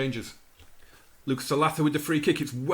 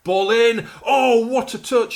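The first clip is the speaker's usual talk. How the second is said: higher, louder cry of amazement; excited shout; 8 semitones higher, 8 dB louder, slower than usual. Real, not acted. Watching his winger's lovely touch, amazed excited shout. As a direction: marvel out loud at the touch. Brilliant touch seen live.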